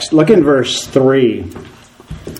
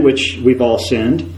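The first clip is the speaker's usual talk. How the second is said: ordinary delivery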